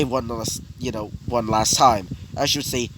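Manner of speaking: usual speaking voice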